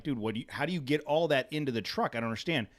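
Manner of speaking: usual speaking voice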